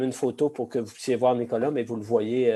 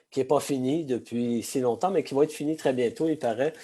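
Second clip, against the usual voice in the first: usual speaking voice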